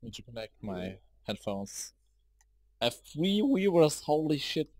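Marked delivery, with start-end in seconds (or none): 2.8-4.7 real thrill at seeing viewers pop up; excited surprise. Real, not acted. New streamer to his chat, amazed at three viewers, excited surprise.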